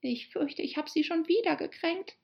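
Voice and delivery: put-on high, anxious girl voice; worried. A narrator's reading. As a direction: confess the blunder with rising worry. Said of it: "rief Alice voller Sorge". (besorgt, mit hoher Stimme)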